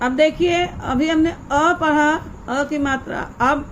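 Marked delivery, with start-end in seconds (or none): none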